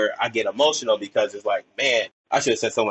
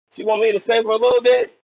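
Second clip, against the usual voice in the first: high, louder disbelieving question; a kid amazed he's been picked; surprised; 11 semitones higher, 4 dB louder than usual. Retold or imitated. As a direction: pitch up in happy surprise as a kid. Retold surprise at the teacher's request.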